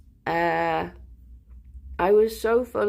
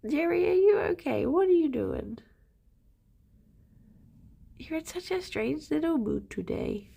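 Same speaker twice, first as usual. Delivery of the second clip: much higher, cooing voice for the pet; pet talk; 8 semitones higher than usual. Real, not acted. Owner fusses over her pet Jerry, high cooing. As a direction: sweet-talk the animal.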